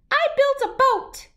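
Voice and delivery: a tiny shrew proposing teamwork. Acted. Shrew offers New to work together, tiny high voice.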